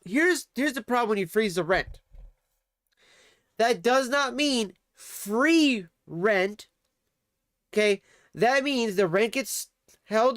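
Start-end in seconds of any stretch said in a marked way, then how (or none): 3.6-6.7 slow and emphatic, each word separate. Real, not acted. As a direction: spell it out slowly. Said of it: "explaining rent freeze".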